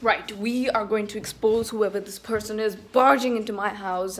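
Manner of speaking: usual speaking voice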